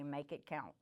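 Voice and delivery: soft, low words of Jesus; quiet inner voice. Retold or imitated. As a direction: say it hushed and firm, a voice only she hears. I instantly hear Jesus say.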